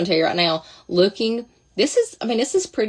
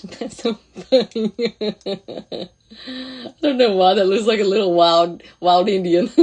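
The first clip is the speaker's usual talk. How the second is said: laughing loudly through the words; 3 semitones higher, 6 dB louder than usual. Real, not acted. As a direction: crack up while talking. Laughing at her own look.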